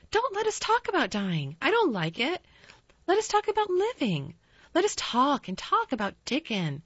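high, pleading insistence; urging. A narrator's reading. Mary urging Colin to talk about living, high and eager. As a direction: steer him to brighter talk eagerly.

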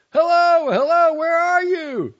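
very high, loud urgent call. A narrator's reading. Husband calling urgently through the house, loud high call. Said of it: "calling again with a new urgency".

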